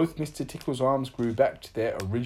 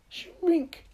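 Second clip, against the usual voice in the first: high, stretched spell word; magical command. A narrator's reading. Little Miss Magic shrinks Mister Tickle's arm, high command. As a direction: give the order on a high note.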